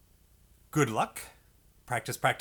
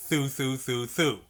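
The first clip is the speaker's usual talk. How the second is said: ordinary talk, nothing marked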